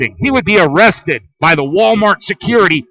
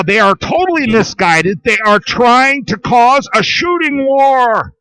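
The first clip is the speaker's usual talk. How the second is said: ordinary talk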